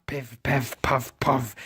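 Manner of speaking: normal talking voice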